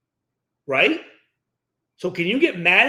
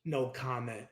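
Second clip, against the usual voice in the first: much softer, lower no comment; deadpan dodge; 9 semitones lower, 14 dB quieter, slower than usual. Real, not acted. Host jokingly refusing to blame teachers, deadpan. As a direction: duck the question dryly. Dodging joke.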